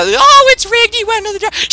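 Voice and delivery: high squeaky mock whine; squeaky voice mocking the commenters; mocking viewer voice. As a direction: mimic a whiny doubter.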